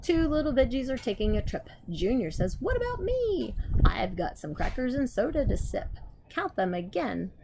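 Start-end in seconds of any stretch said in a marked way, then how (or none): 0.0-5.9 lively storybook reading; reading to children. Acted. Teacher reads a counting book to the class, lively. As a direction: read it bright and bouncy for kids.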